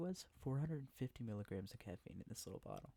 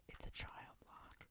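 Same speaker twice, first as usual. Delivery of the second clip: whispering; 10 dB quieter, slower than usual. Real, not acted. ASMR whisper video.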